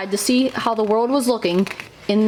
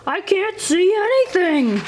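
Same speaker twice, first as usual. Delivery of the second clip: higher, mock-distressed toad; dazzled Toad; 7 semitones higher than usual. A narrator's reading. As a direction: blink into the sun and complain.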